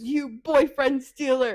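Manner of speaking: loud, drawn-out mock accusation. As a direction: hurl it slowly and loudly, acting out a quarrel. Acted confrontation in retold story.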